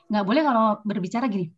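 usual speaking voice

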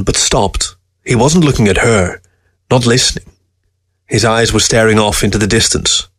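ordinary delivery

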